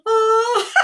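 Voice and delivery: high whooped oh tumbling into laughs; whoop, then let a descending cackle run out; performed tail end of a laughing fit; put-on laughter. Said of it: "catch with a big guffaw".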